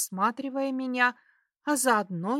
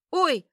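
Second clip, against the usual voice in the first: short "oy" cry, higher and louder; startled cry; 7 semitones higher, 6 dB louder than usual. A narrator's reading. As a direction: yelp out in sudden fright. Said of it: "вскрикиваю я".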